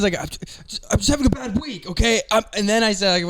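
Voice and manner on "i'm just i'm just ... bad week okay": higher, broken start on a breath; mock tearful plea, on the verge of crying; retold blubber, pitched up. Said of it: "I'm, like, blubbering through my words".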